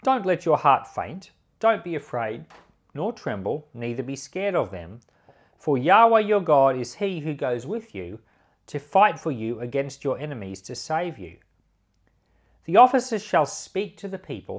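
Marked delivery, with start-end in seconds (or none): none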